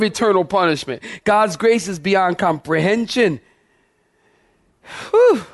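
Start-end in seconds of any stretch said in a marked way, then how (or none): 5.1-5.4 high, loud exhale of exhaustion; breathless whew. Real, not acted. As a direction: blow out hard, winded. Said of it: "I'm out of breath".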